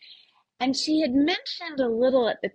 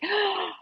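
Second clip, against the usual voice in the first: delighted gasp; 11 semitones higher than usual. Retold or imitated. Horses came to say goodbye.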